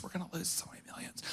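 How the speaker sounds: whispered mock wife line; whispered imitation of the wife. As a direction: breathe it out in a whisper. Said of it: "feminine in nature".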